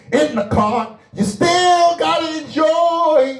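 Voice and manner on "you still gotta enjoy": high, slow preacher's cry; sustained, raised church exhortation; full-force preaching. Acted. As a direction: stretch it out from the pulpit.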